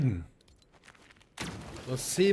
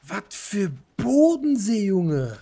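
loud, high, drawn-out disbelief; incredulous yell, pitch soaring then falling; incredulous outburst; 7 semitones higher, 5 dB louder, much slower than usual. Real, not acted. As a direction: stretch it out slowly in loud disbelief. Outburst at mention of Bodensee.

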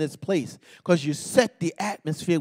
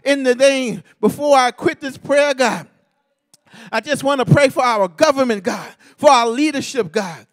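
preaching prayer, rising and crying out to God; fervent prayer; 8 semitones higher, 10 dB louder than usual. Acted. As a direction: pray it out with full fervour, calling on God. Fervent closing prayer at the altar.

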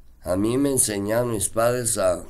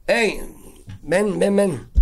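calling out; 9 semitones higher, slower than usual. Retold or imitated. Captain calls him over.

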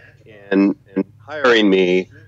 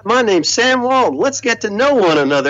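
put-on higher-pitched friendly southern accent; imitating a genial southern accent, pitched high; Sam Walton's southern accent; 10 semitones higher than usual. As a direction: greet the room like a folksy southern boss, voice pitched up. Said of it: "with his southern accent".

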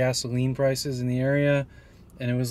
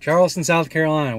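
louder, proclaiming the city name; proud announcement; 4 semitones higher, 6 dB louder than usual. Shown as a demonstration. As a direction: announce it like a tour host. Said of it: "this is more like an Alabama accent".

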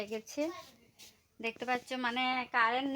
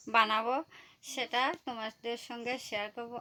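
ordinary talk, nothing marked

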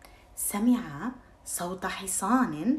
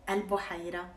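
ordinary delivery, nothing marked

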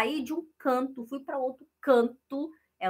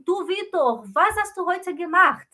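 raised, higher question to a student; calling on student; 8 semitones higher, 9 dB louder than usual. Acted. Teacher calls on Vitor, raised and high. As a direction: call Vitor out across the class. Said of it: "eu falo assim".

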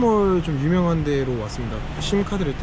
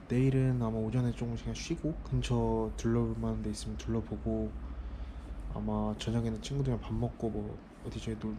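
much softer, low night talk; quiet at night; 5 semitones lower, 13 dB quieter than usual. Real, not acted. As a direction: keep it hushed, people nearby. Late night, people nearby.

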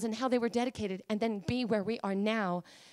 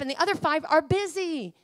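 normal talking voice

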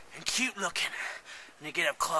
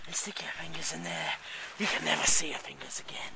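hushed whispered warning; whispering; 4 semitones lower, 7 dB quieter than usual. Pretend.

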